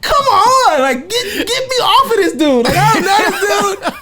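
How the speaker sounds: high, laughing exasperation; high laughing get-me-off-this; laughing plea. Retold or imitated. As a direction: beg through laughter. Laughing plea at awkward FaceTime.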